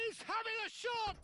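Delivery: high shout on the shot; excited shout. Real, not acted. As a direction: leap up as he shoots. Shot called.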